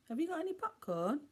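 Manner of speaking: put-on high, quick little-kid voice; small child. A narrator's reading. Tiny child on the floor asking for popcorn, high. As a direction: ask it like an eager tiny child.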